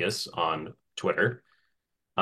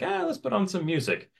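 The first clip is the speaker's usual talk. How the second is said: cheerful; 13 semitones higher than usual. Retold or imitated. Quoting own cheerful thought.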